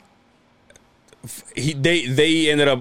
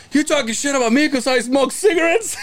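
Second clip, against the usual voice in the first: high-pitched mock grievance; affronted caller caricature, pitched up; Howard; 14 semitones higher than usual. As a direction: whine the accusation in a put-on high voice. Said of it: "that's how Howard talks".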